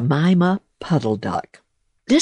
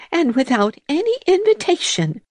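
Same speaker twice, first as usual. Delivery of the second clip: much higher, quick, huffy mouse voice; indignant mouse; faster than usual. A narrator's reading. Mouse retorting to the toad, high and huffy. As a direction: snap back primly. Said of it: "said Missus Thomasina Tittlemouse".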